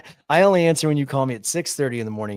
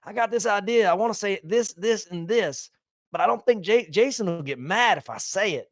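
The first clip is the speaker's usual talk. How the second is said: put-on higher, loose drunk good-old-boy voice; drunk buddy; 7 semitones higher than usual. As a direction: ham up your buddy's boozy pitch. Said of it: "He goes, he goes".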